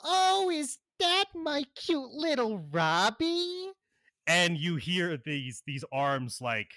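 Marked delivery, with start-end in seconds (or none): 0.0-3.7 very high, louder cartoonish villainess squeal; Harley Quinn. Villainess greeting Robin from behind, high teasing coo. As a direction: gush sweetly in a put-on high voice. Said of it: "As you recognize the voice".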